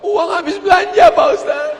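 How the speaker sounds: high, comic tearful wail; mock crying. Woman telling the preacher why she weeps, wailing. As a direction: whine it out as a hammed-up sob. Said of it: "Ibu nangis kenapa?".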